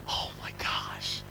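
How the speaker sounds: slow, hushed whisper of mock dread; aghast whisper. Visitor asked to play banjo in church, aghast whisper. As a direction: whisper it slowly under your breath. Horrified aside at being singled out.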